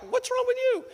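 high, indignant squeak; high squeaky retort of disbelief; taken aback. Acted. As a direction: act shocked and offended. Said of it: "she's taken back".

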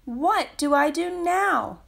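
a lost chick calling out in fear; storybook fear, lost and alone; fearful cry. A narrator's reading. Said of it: "cried Little Penguin fearfully".

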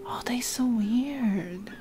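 slow hushed whisper; softly whispered amazement. Real, not acted. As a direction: breathe it out in wonder. Whispered reaction in game.

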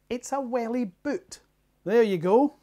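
ordinary talk, nothing marked

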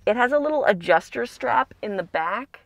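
speaking behind clear plastic; through a face mask. Real, not acted. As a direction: mouth covered by mask. Seen 4: Clear plastic mask worn over mouth throughout; removed near 22.7.

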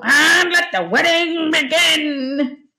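big, slow pirate bellow; Barnacle Bill. A narrator's reading. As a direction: roar orders to the crew, gleefully stretched. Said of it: "grinned Barnacle Bill".

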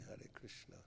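breathy whispered closing greeting. Real, not acted. Teacher ending his class in a whisper. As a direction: whisper it under your breath.

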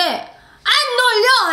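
high, drawn-out yell; stretched fan-chant shout; imitated crowd cheer. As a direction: holler back like a mass of fans. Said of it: "그런 환호성".

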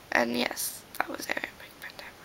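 quick unvoiced whisper; whispered aside. Real, not acted. Aside after reciting a line.

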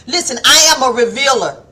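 normal talking voice

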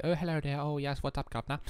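mock English accent. Australian imitating an English greeting, mock English accent. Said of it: "will do English accents where they say".

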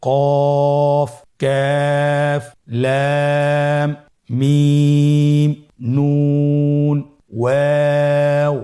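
slow held recitation of the letter names; long drawn letter names. Shown as a demonstration. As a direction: recite কফ কাফ লাম মিম নুন ওয়াও stretched out slowly. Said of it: "শুরু থেকে আবার আরেকবার বলি".

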